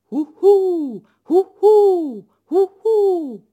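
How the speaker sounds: high, loud falling hoots; owl hooting. Acted. Long-eared owl trying out its new song, loud high hoots. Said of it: "és rá is kezdte".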